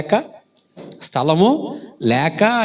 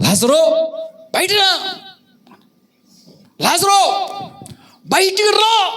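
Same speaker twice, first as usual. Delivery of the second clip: high, ringing shout of summons; imitated commanding shout, pitched way up; loud calling; 13 semitones higher, slower than usual. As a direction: call the dead man out of his tomb. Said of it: "బిగ్గరగా పిలిచాడు అట".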